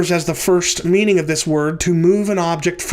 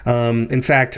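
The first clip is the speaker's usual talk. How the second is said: ordinary talk, nothing marked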